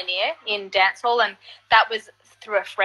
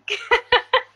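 short rising laugh; amused laugh; high laugh; 13 semitones higher than usual. Real, not acted. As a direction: laugh at herself. Laughing at refusing gig.

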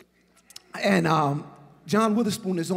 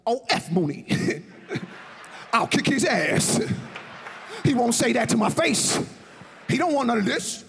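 hammed-up tough-guy brag from Detroit; John's angry bluster; 6 semitones higher than usual. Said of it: "he was like".

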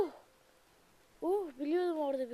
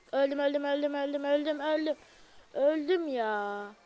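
panicked; 3 semitones higher, 6 dB louder than usual. Real, not acted. Dying in the game.

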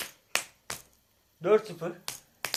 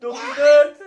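high, loud shout; excited score call; 7 semitones higher, 10 dB louder, slower than usual. Real, not acted. Father one point from winning against his son, excited shout. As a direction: belt out the score near victory.

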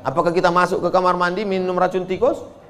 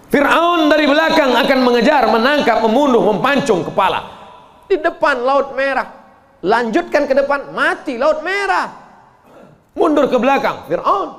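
loud, high, urgent telling of the chase; 8 semitones higher, 3 dB louder than usual. Acted. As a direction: raise the alarm as if Pharaoh is behind you. Urgent escape from Pharaoh.

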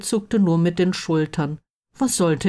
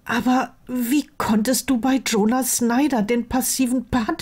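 higher-pitched, exasperated character voice; incredulous best friend; 6 semitones higher than usual. Acted. Friend appalled by the choice of lovers, incredulous. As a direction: protest in high-pitched disbelief.

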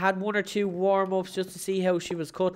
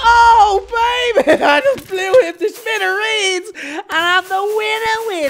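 high, loud laughing whoop; victory cheer; 10 semitones higher, 9 dB louder, slower than usual. Real, not acted. Gamer winning the duel, high laughing cheer. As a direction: celebrate the win with laughter.

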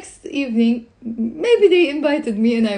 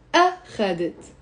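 أخذت as A, then kha-det after a tiny break; first syllable set apart. Shown as a demonstration. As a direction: isolate the stressed أ in أخذت. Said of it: "the first one was on the أ".

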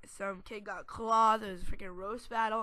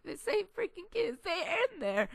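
high, loud exasperated outburst; 10 semitones higher, 5 dB louder than usual. Real, not acted. Kid telling viewers about recess, exasperated. Excited retelling of the same kids.

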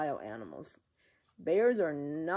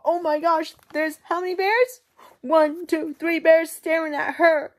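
higher, louder gasping alarm; alarmed storytelling; 11 semitones higher, 12 dB louder than usual. A narrator's reading. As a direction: tell it like a shocking surprise. Goldilocks wakes to see bears.

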